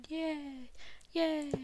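high, drawn-out little "yay"; mock cheer. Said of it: "you, like, go".

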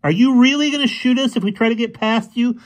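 high, heated challenge; confrontational. A narrator's reading. Fergie confronts the boy with the rifle, challenging. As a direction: dare him with pointed questions.

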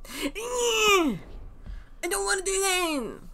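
high, whiny protest; high whiny complaint at the game; high whine. Real, not acted. Whining at game.

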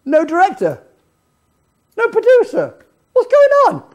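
hammed-up star demanding attention; mock indignant. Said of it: "walking back and forward saying".

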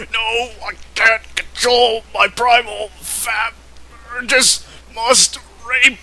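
high, strained cries of a man losing control; desperate struggle. Acted. Wolf fights the incubus.

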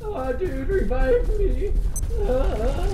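high, loud desperate pleading; panicked whine. Real, not acted. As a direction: beg for help, voice way up. Downed player begging revive.

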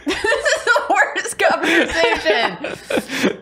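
laughing. Real, not acted. Laughing at the story.

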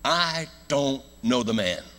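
high, slow, insistent denial; drawn-out, forceful oath; emphatic sworn denial. Acted. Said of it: "he swore to them".

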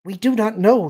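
high, loud alien voice; earnest young alien. Acted. Alien answers Mara's question, earnest.

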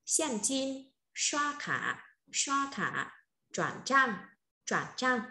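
teacher's slow, measured vocabulary drill; model word reading. Shown as a demonstration. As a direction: read each word twice, evenly, leaving gaps to repeat. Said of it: "Em cô đọc một lần từ mới này".

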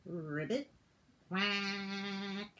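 deep soft croak and quack; low critter voices; low animal calls. Acted. The frog and duck answer in low voices.

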